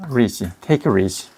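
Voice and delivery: "take 리지" with the t turned into r, "teyk-rizi"; "take 리지" flapped; linked take it easy. Shown as a demonstration. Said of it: "여기에 R이 바뀌잖아".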